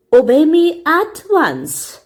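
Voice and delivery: stern command. A narrator's reading. Teacher ordering Anne to move seats, stern and slow. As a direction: spell out the command.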